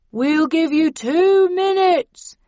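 high, loud, very slow ultimatum; stretched-out shouted warning; bullhorn shout. A narrator's reading. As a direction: call a deadline through a bullhorn. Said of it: "said the voice into the bullhorn".

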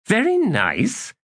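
high, slow questioning repeat; incredulous echo. A narrator's reading. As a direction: draw it out high, rising in disbelief. Tehol echoes the faint praise.

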